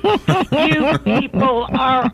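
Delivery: high, loud outraged rant, slowing at the end; mother ranting. Retold or imitated. Mother arriving with sword and cane, shouting at office staff. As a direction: tear into the whole office.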